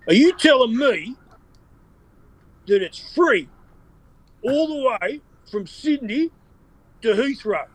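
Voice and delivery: high, put-on accent broken by long pauses; Con Constantine accent. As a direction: draw out the punchline in the high accent impression. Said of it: "and Con goes".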